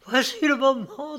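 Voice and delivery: high, gleeful announcement; joyous and high-pitched; joyful tone. A narrator's reading. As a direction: announce it with joy, pitch lifted. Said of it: "avec un accent joyeux".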